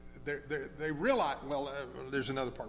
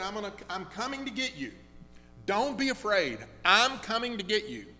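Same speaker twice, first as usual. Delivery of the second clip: loud, urgent comfort from a father; reassuring father; 6 semitones higher, 6 dB louder than usual. Retold or imitated. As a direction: call out firmly so she stops being afraid. Father reassures crying daughter on phone.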